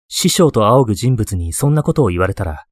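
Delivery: plain reading voice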